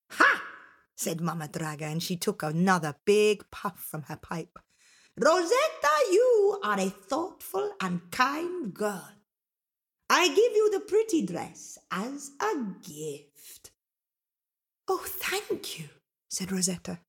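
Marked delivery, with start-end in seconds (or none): 0.1-0.4 sudden high "Ha!"; pleased ogress exclaiming; ogress Mama Draga. Acted. Mama Draga reacting to Rosetta's kind offer, a high exclamation. 5.2-13.7 slow, high, swooping ogress voice; ogress Mama Draga, approving. Acted. Mama Draga rewarding kind Rosetta, slow and approving. 14.9-15.9 high, soft, slow thanks; sweet girl's softly lifted voice; grateful Rosetta. Acted. Rosetta thanking Mama Draga for the gift, soft and grateful.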